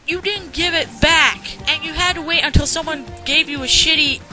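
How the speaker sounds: normal talking voice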